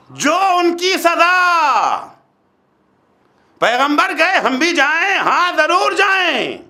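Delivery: high, drawn-out proclamation; forceful preaching. Real, not acted. Preacher answering whether believers should go, forceful preaching. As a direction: stretch the key words and ring them out.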